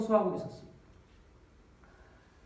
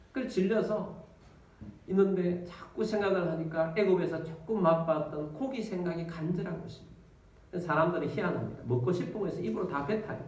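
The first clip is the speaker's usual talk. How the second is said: ordinary talk, nothing marked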